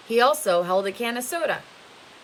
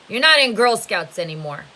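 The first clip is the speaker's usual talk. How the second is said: taunting; 2 semitones higher, 9 dB louder than usual. Acted. Mister Sir taunts Stanley.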